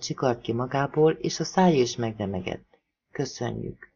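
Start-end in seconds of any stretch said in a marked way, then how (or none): 3.1-3.9 a hushed thank-you, barely voiced; soft whisper. A narrator's reading. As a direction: whisper the thanks with fake tears.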